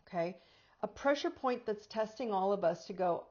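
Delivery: ordinary delivery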